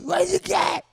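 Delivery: high, loud shout; shouting over the bar noise. Acted. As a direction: yell the question across a loud bar. Punching game at loud bar.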